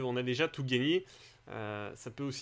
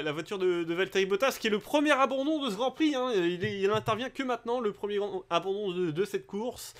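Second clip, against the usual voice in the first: higher, louder race call; motorsport commentator; 4 semitones higher, 3 dB louder than usual. Acted. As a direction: announce the retirement with lift. Calls first retirement. (commentateur de course animé, voix plus haute)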